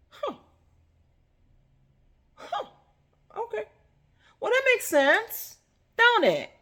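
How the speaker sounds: high, loud mock dawning realization. Preacher feigning sudden understanding, high and loud. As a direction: act like it just clicked.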